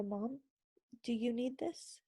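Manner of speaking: put-on soft, sweet child asking; kids' voice. Her kids offering the oil and Bible, soft and sweet. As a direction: gently offer mom the oil like a little kid. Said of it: "they'd put it together and go".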